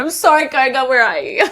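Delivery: high, cooing apology to the dog; baby-talk your pet to say sorry; sing-song sorry to a dog. Pretend.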